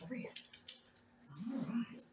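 ordinary talk

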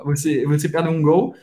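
ordinary talk, nothing marked

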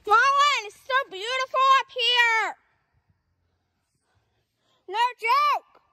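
high excited call. Real, not acted. Calling friend up trail.

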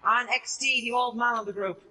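high, hyped channel intro voice; YouTuber intro. Acted. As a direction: open the video like a streamer. YouTube intro, announcer style.

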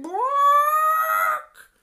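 very high, loud quack; hammed-up high quack; silly quacking hen. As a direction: squawk a quack for the joke. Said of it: "And the hen said".

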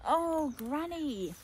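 a drawn-out mock wail at the mess; dismayed cry. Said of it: "I cried".

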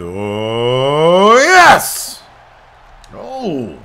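rising to a cheer. Real, not acted. Player cheering as his wrestler wins, rising loud whoop. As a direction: build from oh into a triumphant yes. Winning move lands.

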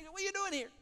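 high, loud, incredulous demand; God's question. Acted. God demanding of Elijah, high and loud.

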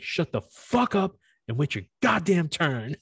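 hammed-up angry scolding; mock furious rant. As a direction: spit each word out like a drill sergeant. Retold ranting at classmate.

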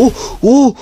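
high, loud triumphant yell; excited yell. Real, not acted. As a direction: shout it out like you just won. Revenge kill, celebrating.

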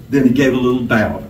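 plain reading voice

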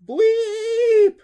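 long, high, loud shriek; drawn-out high scream; terrified scream. A narrator's reading. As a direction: scream it out in terror, drawing it out. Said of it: "Dusty screamed".

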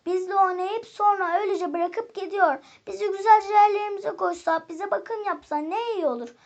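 high, loud call to the others. A narrator's reading. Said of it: "bütün oyuncaklara seslendi". (yüksek sesle seslenerek)

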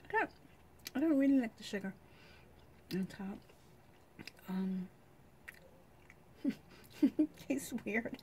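talking between bites; chewing while talking. Real, not acted. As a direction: mouth full of candy. Seen 1: Holds soft candy, bites and chews it while talking throughout.

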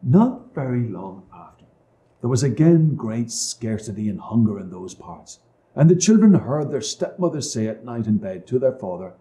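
plain reading voice

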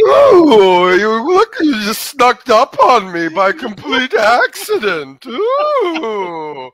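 high, loud, slow coquettish coo; playing the coy tease caught by surprise; coy seductress. Flirting with Sparkle in a coquettish high coo.

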